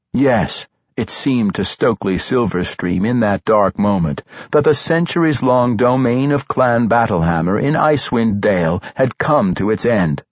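ordinary delivery

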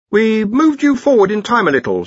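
a touch of nervous evasion; dreading questions. Acted. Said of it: "tone of his voice suggested that he dreaded being asked why".

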